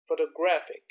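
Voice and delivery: "photographic" punched on "graph"; stress on third syllable. Shown as a demonstration. Teacher showing stress shift to students, third-syllable stress. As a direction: hold back until "graph" in "photographic", then hit it. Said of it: "second, and the third syllable in different words".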